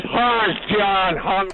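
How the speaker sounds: slow, drawn-out Aberdeenshire Doric greeting; Doric accent. Retold or imitated. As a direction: greet him slowly like a Doric boss from Aberdeenshire. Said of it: "the accent is called the Doric accent".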